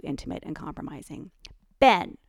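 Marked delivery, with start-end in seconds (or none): 1.8-2.2 loud sharp bark of his name. A narrator's reading. Ellie catching her husband with another woman, barked. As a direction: snap his name across the room. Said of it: "Ellie barked".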